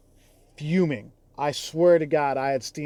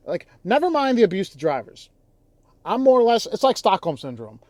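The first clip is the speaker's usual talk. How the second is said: higher and louder, exasperated outburst; heated rant; 6 semitones higher, 7 dB louder than usual. Real, not acted. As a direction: let the frustration boil over. Ranting about abuse to drivers.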